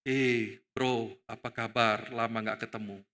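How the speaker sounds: low, leisurely, casual hello; friendly greeting. Shown as a demonstration. Texting a long-unseen friend, low casual greeting. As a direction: greet a pal in a low, easy voice. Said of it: "Kita mulai W A".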